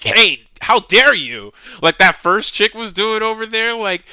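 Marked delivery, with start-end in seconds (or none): 0.0-1.5 mock indignant. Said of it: "to tell me, like".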